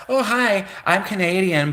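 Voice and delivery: chirpy mock hello; cheery applicant. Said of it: "the person's like".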